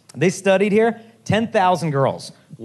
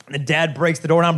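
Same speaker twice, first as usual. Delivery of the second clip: ordinary talk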